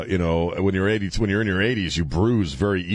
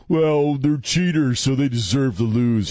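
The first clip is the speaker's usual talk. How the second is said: higher, louder mimicry of complainers; mocking whine; 6 semitones higher, 3 dB louder, slower than usual. Host fed up with sore fans' refrain, mocking. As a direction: parrot the tired complaint mockingly, pitched up.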